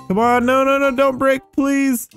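high-pitched begging; pleading. Real, not acted. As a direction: plead with the game not to fail. Pleading with the buggy game.

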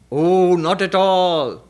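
slow, unhurried, magnanimous drawl; big bull reassuring the mosquito, slow and easy; easygoing big bull. Acted. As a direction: shrug it off like a huge calm bull.

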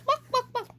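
high clucking buck buck; hen clucking. A narrator's reading. Hen answers the bird with high clucks.

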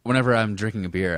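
ordinary talk

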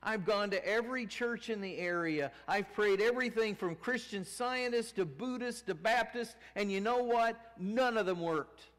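high, loud exasperated patient; bitter rant. Retold or imitated. Cancer patient ranting at Miss Miller, loud and bitter. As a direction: throw every church back at her. Woman ranting about prayer.

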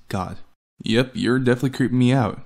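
ordinary delivery

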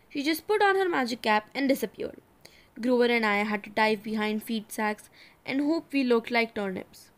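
plain reading voice

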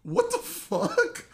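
high, drawn-out shocked laughing "what"; laughing disbelief. Real, not acted. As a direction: squeal it through laughter, incredulous. Shocked laughing at being exposed.

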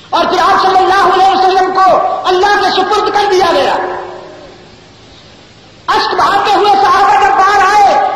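high, sustained sermon lament; impassioned preaching. Acted. Preaches at full force.

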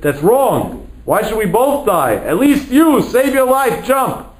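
high, impassioned pleading; urgent plea. Retold or imitated. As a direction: urge him desperately, pitch climbing high. Urges brother to jump to safety.

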